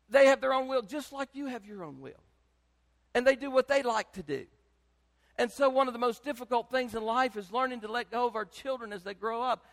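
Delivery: normal talking voice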